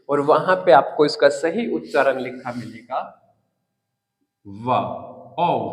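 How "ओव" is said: "ओव" ending in a v sound; correct pronunciation. Shown as a demonstration. Teacher reads out the dictionary pronunciation, "ओव" with v. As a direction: say "ओव" with a final v, not f. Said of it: "इसका सही उच्चारण होता है".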